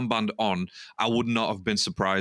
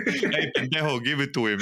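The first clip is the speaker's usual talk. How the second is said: laughing, higher mock Argentine accent; 4 semitones higher than usual. Podcaster laughing through Enzo's line in a mock Argentine accent. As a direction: ham up the Spanish slang with a laugh. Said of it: "In the accent, in the accent".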